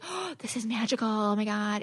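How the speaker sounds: soft, dreamy, lovestruck murmur; mock dreamy gush. Love-bombed partner gushing over the magic, soft dreamy murmur. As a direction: sigh it out like you're smitten. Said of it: "make you feel like".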